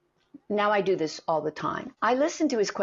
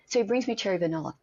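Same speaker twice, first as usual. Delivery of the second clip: ordinary talk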